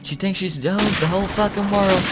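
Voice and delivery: high-pitched mock exasperation; imitating fed-up mom. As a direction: complain as the mother at her wits' end. Fed-up mom complains to cop.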